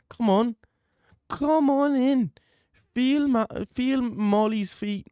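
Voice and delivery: hammed-up high Scouse coaxing; mock Molly McCann.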